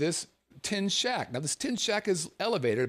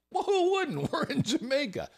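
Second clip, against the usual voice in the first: sarcastic; 12 semitones higher than usual. Real, not acted. Sarcastic aside about ganja plantation.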